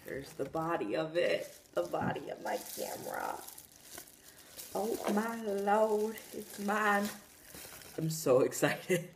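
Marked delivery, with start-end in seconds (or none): 4.7-7.1 giddy. Real, not acted. Thrilled at own camera.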